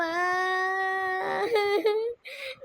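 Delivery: a drawn-out hammed-up sob; mother sobbing to the doctor; fake crying wail. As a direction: wail like a mother in comic grief. Mother wails again.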